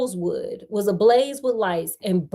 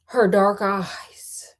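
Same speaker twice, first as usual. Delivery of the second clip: whispered; much slower than usual. A narrator's reading. Whispered slowly.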